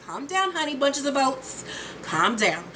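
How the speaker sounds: softly cooed, falling to a hush; pacify an upset partner, playfully sweet; mock soothing. Acted soothing of agitated partner.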